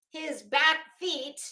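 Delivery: ordinary delivery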